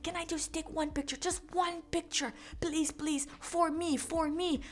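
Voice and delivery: high, soft begging imitation; mock wheedling, small high voice; pleading dad. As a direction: imitate a man softly begging a favour.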